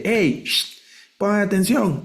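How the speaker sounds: high, hushing call to get someone's attention. Shown as a demonstration. Teacher acts out the Hebrew interjection as a high hushing call. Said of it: "porque es de".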